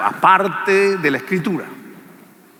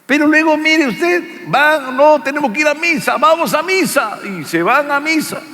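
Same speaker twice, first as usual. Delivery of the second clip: high, loud sarcastic telling; mock the hypocrites going to mass; preacher ridiculing a fighting couple who then go to mass; 12 semitones higher, 4 dB louder than usual.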